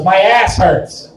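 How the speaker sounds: slow, blunt complaint; deadpan mother-in-law. Retold or imitated. Mother-in-law greeting him after a long drive, slow deadpan. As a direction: drag it out like a tired grump. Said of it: "she goes".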